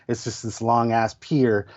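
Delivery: usual speaking voice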